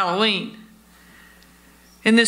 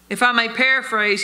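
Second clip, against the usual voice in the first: usual speaking voice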